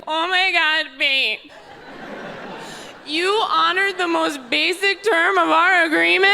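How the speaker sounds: high, gushing fake adoration; hammed-up breathless lovestruck girlfriend; mock swooning. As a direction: swoon sarcastically, drawing out the praise. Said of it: "Like I'm supposed to swoon".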